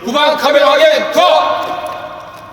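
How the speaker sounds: loud echoed safety call; shouted check call. Real, not acted. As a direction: bark back the checklist item.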